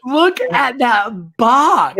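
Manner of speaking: slow, drawn-out astonishment; real amazement. Real, not acted. Friend showing the huge box in the picture, amazed. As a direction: stretch every word in awe.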